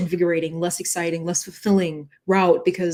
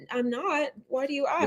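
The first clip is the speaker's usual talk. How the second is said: softer, guarded reply; quiet reply; 6 semitones higher, 7 dB quieter than usual. Retold or imitated. To the caller, a soft wary answer. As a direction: answer him quietly and warily. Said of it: "I was like".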